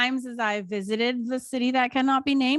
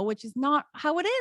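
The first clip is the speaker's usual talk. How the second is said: ordinary talk, nothing marked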